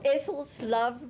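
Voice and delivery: normal talking voice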